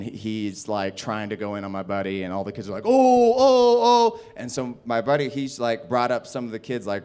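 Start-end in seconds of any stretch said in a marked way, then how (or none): 2.9-4.2 loud, high "oh" hooting; mock taunting chorus, pitched up and loud; imitated crowd jeer. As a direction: egg on a fight like a crowd of kids. Imitating the crowd of kids jeering.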